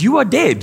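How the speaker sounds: high punchy declaration; emphatic. Acted. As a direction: hit it hard, you are dead. Preacher punches key line.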